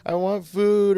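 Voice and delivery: high pleading whine; whiny begging. Retold or imitated. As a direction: beg like a desperate addict. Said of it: "they would always hear crying, manipulation".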